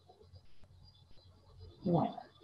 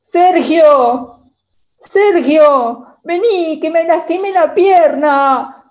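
loud high call across the field; calling for help; 7 semitones higher, 11 dB louder, much slower than usual. Retold or imitated. Hurt girl calling brother Sergio from the field, loud high call. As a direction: shout for your brother, hurt.

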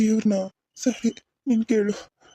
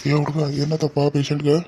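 village doctor; 5 semitones lower than usual. Acted. Doctor arrives asking about patient.

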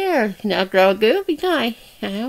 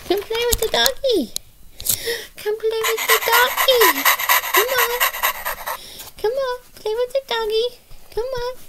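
very high little puppet voice; coaxing doll; 10 semitones higher than usual. Pretend. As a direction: coax a playmate in a high toy voice. Doll coaxes toward toy dog.

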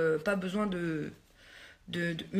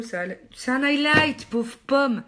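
exasperated at herself; 5 semitones higher, 6 dB louder than usual. Real, not acted. Realises highlighter went under eye.

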